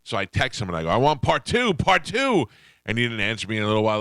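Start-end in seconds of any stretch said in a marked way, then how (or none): 0.9-2.5 higher, louder, insistent; playful pleading demand; eager demand. Retold or imitated. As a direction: beg for more like a hooked fan. Demanding the rest by text.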